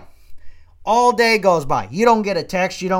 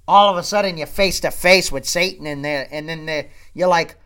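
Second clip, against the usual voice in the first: ordinary talk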